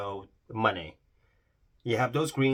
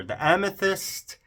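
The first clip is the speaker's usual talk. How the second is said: higher, louder, slower and savoured; excited; 10 semitones higher, 9 dB louder, slower than usual. Real, not acted. Showing viewers her amethyst swatch, excited and gushing. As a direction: gush over the name, thrilled. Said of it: "Sorry, I got really excited here".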